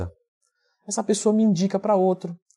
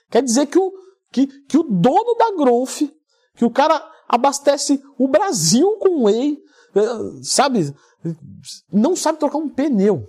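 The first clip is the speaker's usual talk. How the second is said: higher, louder and slow, in disbelief; incredulous; 7 semitones higher, 4 dB louder, slower than usual. Real, not acted. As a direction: spell out your amazement word by word. Amazed friend can't change tyre. (incrédulo, mais alto, agudo e devagar)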